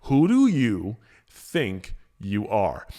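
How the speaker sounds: low, slow, earnest question; sincere asking. Shown as a demonstration. Host posing the key question sincerely, slow. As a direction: ask it gently and mean it. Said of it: "I'm gonna say it because I think it's important".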